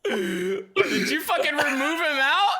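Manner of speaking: mock fury you can't keep straight; laughing outrage. Real, not acted. Friend moved him, laughing outrage.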